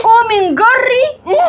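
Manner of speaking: high, slow yelled order; harsh shouted command; cruel shout. Acted. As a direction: bark at her to get up.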